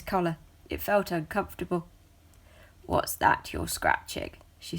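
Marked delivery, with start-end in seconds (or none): 2.8-4.3 much lower, gruff; deep gruff cat voice. A narrator's reading. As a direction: growl the question.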